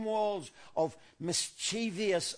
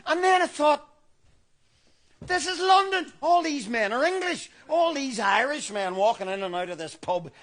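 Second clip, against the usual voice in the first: higher, louder, laughing rant; building mock hysteria; 5 semitones higher, 3 dB louder than usual. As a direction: work yourself into a frenzy.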